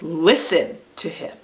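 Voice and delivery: "Listen" stretched out, "to him" dropping away; stress on LISTEN. Shown as a demonstration. Teacher showing children the first-word reading, stress on "Listen". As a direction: lean hard and slowly on "Listen". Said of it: "when we stress the first word".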